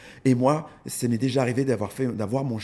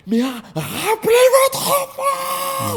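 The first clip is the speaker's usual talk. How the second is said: high, loud panicked yell from far away; mock shout; 9 dB louder, much slower than usual. Imagined dog owner yelling at a parent to call back their child. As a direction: shriek across the pavement at a stranger. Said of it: "je me mettais à quinze mètres à faire".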